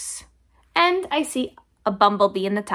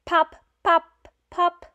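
popping sounds; 7 semitones higher, 4 dB louder than usual. Shown as a demonstration. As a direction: pop pop pop, higher and louder. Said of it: "He can sound like a cork".

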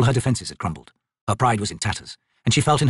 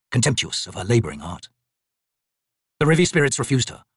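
usual speaking voice

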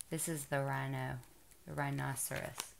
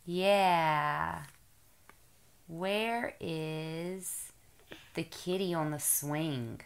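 put-on reading to a toddler; 3 semitones higher, 3 dB louder than usual. Mother reading picture book to toddler.

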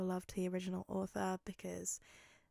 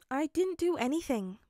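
higher-pitched protest; defensive protest; 7 semitones higher, 5 dB louder than usual. Acted. Midoriya answering Bakugo's accusation, defensive. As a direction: protest your innocence in a raised pitch.

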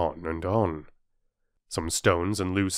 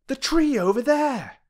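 high, urgent pointing cry; excited boy; 4 dB louder than usual. A narrator's reading. Boy pointing out the moving tree, high and urgent. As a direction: point it out in alarm. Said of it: "cried Peter suddenly".

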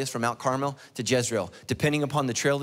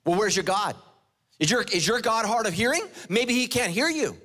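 higher, sneering taunt; mock the false prophets; 5 semitones higher than usual. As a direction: Elijah taunting the prophets of Baal, mocking. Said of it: "Elijah starts mocking them".

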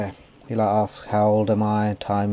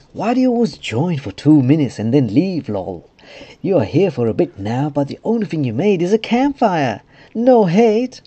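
high, loud mock-nagging character voice; silly voice; 7 semitones higher, 7 dB louder than usual. Realm owner complaining about the campfire, silly voice. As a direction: needle them in a high silly voice. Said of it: "silly voice on".

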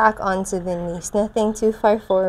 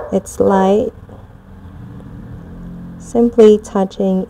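guided meditation; 4 dB louder than usual. Acted. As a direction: guide them gently through the visualization. Guided moon visualization.

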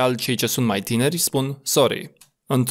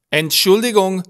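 "Entschuldigung!" pitched up and louder, ENT accented; stressed first syllable; 7 semitones higher, 5 dB louder than usual. Shown as a demonstration. Teacher showing lost patience, loud high stress on ENT in "Entschuldigung!". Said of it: "când aceste trei litere din acest cuvânt Entschuldigung sunt accentuate".

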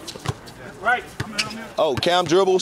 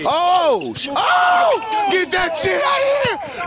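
block call shout; 9 semitones higher, slower than usual. Real, not acted. Filming a pickup game, yelling at a big block. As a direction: yell it out as the shot gets swatted.

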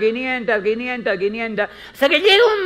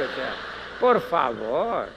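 exasperated; 8 semitones lower, slower than usual. Acted. Dismissive aside to newcomer.

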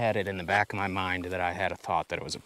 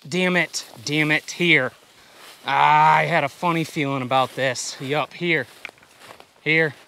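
loud, high alarmed calls; urgent shouting; 8 semitones higher, 11 dB louder, slower than usual. Real, not acted. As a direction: shout in a panic. Dog hit by porcupine.

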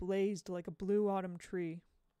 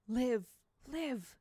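command; 4 semitones higher than usual. Acted. Mister Dark commands the corpse.

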